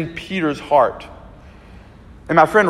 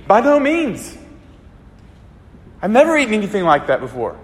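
protesting Peter; 4 semitones higher than usual. Retold or imitated. Peter protesting to God about eating unclean animals, higher pleading protest. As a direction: push back hard, half begging.

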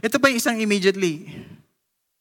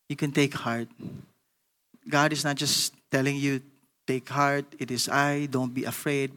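softer, lower tender preaching; gentle encouragement; 7 semitones lower, 7 dB quieter than usual. Acted. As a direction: speak comfort softly to the hurting. Soft encouraging altar-call preaching.

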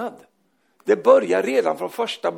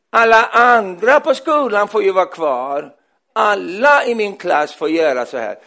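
put-on loud, drawn-out childish complaint; whining child; 3 semitones higher, 8 dB louder, slower than usual. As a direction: drag it out like an aggrieved kid. Child arguing to stay. (gnälligt, utdraget barnprotesterande)

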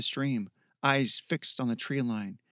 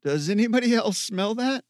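higher, tense and uneasy; scared, voice trembling a little; shaky voice; 7 semitones higher, 4 dB louder than usual. Acted. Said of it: "Ken's voice shook".

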